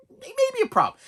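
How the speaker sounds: suddenly very high, mock-alarmed squeak; high-pitched worry. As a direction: leap way up in pitch, playing worried. Same thought again, squeaked.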